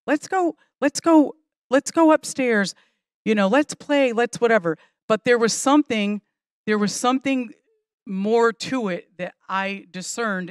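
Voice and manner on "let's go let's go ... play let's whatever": put-on high, insistent kid pleading; lightly voiced boy begging mom to come play; eager child begging. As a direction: urge mom upstairs again and again.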